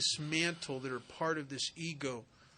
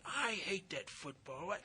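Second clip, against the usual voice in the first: ordinary delivery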